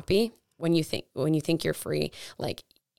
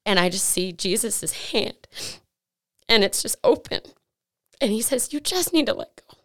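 real crying, voice climbing high; choked up, fighting back tears; tearful; 5 semitones higher than usual. Real, not acted. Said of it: "I'm getting emotional. I hate crying".

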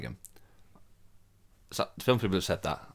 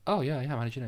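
higher-pitched slip into Brummy; Brummy accent; 7 semitones higher than usual. Retold or imitated. Chatting to a minibus driver, switching into Brummy. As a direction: answer in a chatty Brummy lilt. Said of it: "naturally switched into Brummy".

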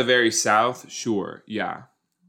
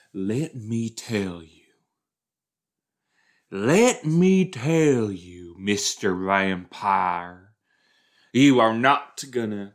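put-on Southern accent; 4 dB louder, slower than usual. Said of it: "if they had Southern accents".

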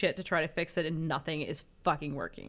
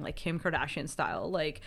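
ordinary talk, nothing marked

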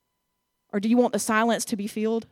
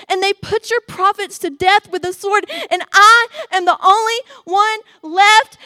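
complaining prophet; 8 semitones higher, 9 dB louder than usual. Acted. Elijah's complaint to God.

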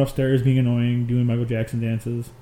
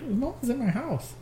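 imitating the kid; 11 semitones higher, 6 dB quieter, slower than usual. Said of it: "he's like".

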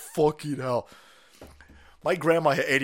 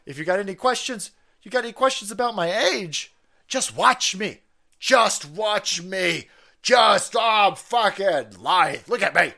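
louder, hammed-up old man boasting; mock Biden; 5 semitones higher, 5 dB louder, slower than usual. Biden brushing off questions about his age, hammed-up. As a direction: bluster to shut down questions. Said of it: "He says".

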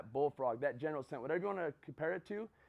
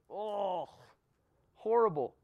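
disgusted groan; 2 semitones higher, slower than usual. Retold or imitated. Swallowing the frog.

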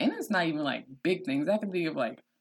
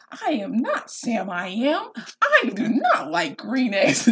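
put-on slow, emphatic child reader, pitched up; reading with exclamation points; 4 semitones higher, 4 dB louder, slower than usual. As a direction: punch out each word like a proud new reader. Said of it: "putting in those exclamation points".

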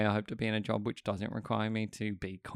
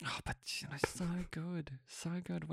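breathy unvoiced whisper; 7 semitones higher, 10 dB quieter than usual. Real, not acted. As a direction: hush it like a confession. Whispered fond aside.

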